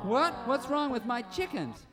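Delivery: high, startled and worried; shocked homeowner, voice jumping up; alarmed. Acted.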